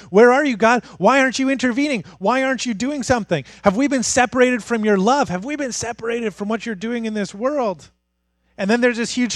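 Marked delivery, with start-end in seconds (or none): 0.1-7.9 high, urgent appeal to God; pleading questions. Retold or imitated. Doubters asking God why he won't intervene, pleading. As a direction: fire off anguished questions, imitating the doubters.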